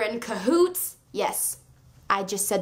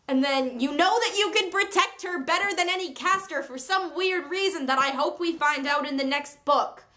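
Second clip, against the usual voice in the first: ordinary talk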